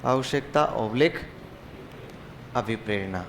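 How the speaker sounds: slow, word by word for notes; slow dictation. Shown as a demonstration. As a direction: spell it out slowly for the class. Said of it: "teacher repeats slowly for dictation". (धीमे-धीमे लिखवाना)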